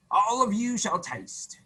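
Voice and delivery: a soldier proudly inviting everyone; grand invitation. Acted. Soldier invites all to eat.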